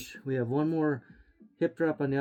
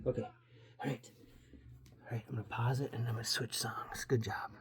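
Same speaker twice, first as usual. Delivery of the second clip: soft lower whisper, off-mic aside; 5 semitones lower, 9 dB quieter, faster than usual. Real, not acted. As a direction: drop to a whisper for a quick aside. Aside while pausing the music.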